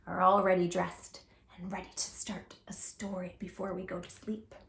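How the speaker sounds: whisper. Shown as a demonstration. Bedtime story read to children.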